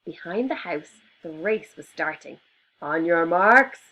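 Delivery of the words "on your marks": loud, slow starter's call; yell. Acted. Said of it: "yelled a rhinoceros".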